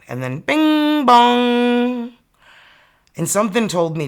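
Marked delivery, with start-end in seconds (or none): 0.4-2.1 slow, high sung bing bong of a doorbell; doorbell imitation. Mimicking the doorbell that rang late at night, slow and high. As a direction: chime it out like the doorbell.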